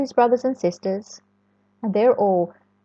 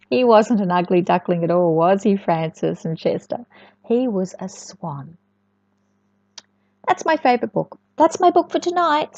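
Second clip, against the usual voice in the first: ordinary delivery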